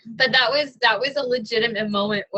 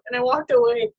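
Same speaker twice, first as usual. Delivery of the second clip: ordinary delivery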